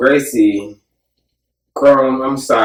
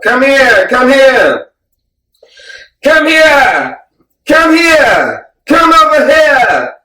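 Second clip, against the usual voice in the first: high, loud imitated screaming; mock screaming; 7 dB louder, slower than usual. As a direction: yell it like an enraged fighter. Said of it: "Marab kept screaming at".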